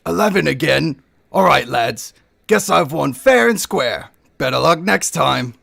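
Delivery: lightly voiced cocky elf; card sharp gloating over the dice; smug elf gambler.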